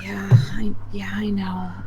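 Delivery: whispered agreement. Retold or imitated. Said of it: "she's like".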